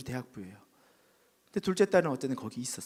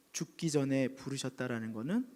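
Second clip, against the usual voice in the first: ordinary delivery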